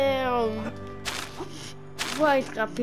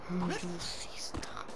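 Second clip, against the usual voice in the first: sussurro procurando alguém; whisper; 4 semitones lower, 11 dB quieter than usual. Acted. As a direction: sussurre bem baixinho. Vilão procura em segredo.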